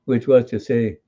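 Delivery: normal talking voice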